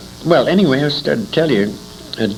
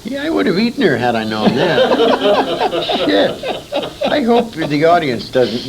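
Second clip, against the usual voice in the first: higher and slow, laughing over a crude joke; laughing, bawdy; 7 semitones higher than usual. Real, not acted. Old man laughing at his own joke for the interviewer.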